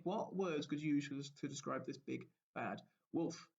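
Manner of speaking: ordinary talk, nothing marked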